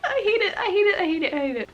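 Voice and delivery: high, whimpering I hate it; whiny distress. Real, not acted. Vlogger squirming at a tense scene, whimpering high. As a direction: whine it out, half crying.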